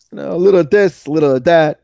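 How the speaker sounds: hammed-up New Yorker catchphrase; New York accent. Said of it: "You sound so New York".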